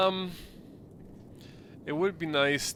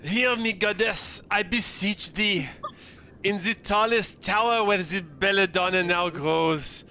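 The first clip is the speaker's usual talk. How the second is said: higher, hammed-up prayer voice; accented prayer; 5 semitones higher than usual. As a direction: plead to the goddess in accent. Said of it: "I say, Deuce says".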